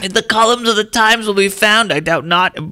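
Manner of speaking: put-on high, declaiming old-timey orator. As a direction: boom it out like a grand old publisher. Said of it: "old-timey voice".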